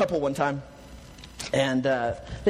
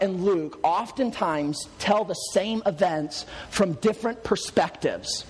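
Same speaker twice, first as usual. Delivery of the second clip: ordinary talk, nothing marked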